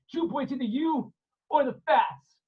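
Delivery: bully him; menacing sailor. Acted. Gentile threat again.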